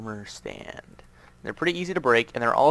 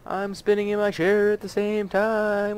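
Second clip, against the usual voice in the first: excited; 9 semitones higher, 3 dB louder than usual. Real, not acted. Spinning in chair while riding.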